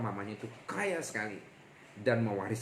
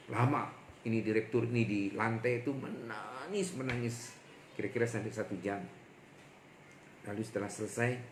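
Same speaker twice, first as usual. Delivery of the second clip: ordinary talk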